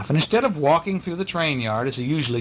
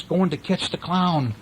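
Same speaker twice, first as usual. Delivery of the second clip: usual speaking voice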